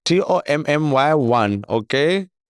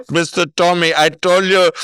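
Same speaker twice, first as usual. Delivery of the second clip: a lightly voiced call-centre woman, louder and higher; mock scam caller; 4 semitones higher, 4 dB louder than usual. Scammer insisting to the caller on the phone, lightly mocked. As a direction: insist like a pushy phone scammer, half-mocking. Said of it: "She was like".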